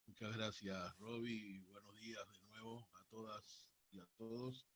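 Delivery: faint and distant, far from the mic; speaking away from the phone; phone held away. Real, not acted. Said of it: "Néstor, acércate al teléfono".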